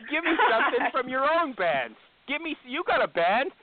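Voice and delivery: loud insistent demand. Real, not acted. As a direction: press him hard for his own band. Host demanding a song loudly.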